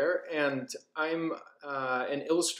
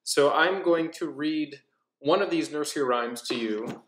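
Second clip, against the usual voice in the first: plain reading voice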